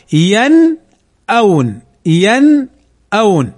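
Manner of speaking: slow ينأون, "yan" then "awn", n unmerged; clear izhar. Shown as a demonstration. Teacher correcting the vowelled forms, slow clear "yan-awn" in ينأون. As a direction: sound the noon of ينأون fully, then a clean hamza. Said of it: "نظهرها ولا ندغمها".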